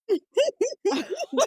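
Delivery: high burst of laughter; bright laugh. Real, not acted. Laughing at cue.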